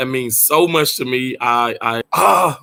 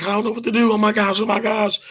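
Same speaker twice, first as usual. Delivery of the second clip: lightly higher, fretful mock panic; panicking woman; 6 semitones higher, faster than usual. Mimicking the panicked hotel neighbour, mild mock panic. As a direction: imitate her fussing "oh my gosh". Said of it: "She's like".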